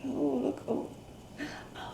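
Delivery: ordinary delivery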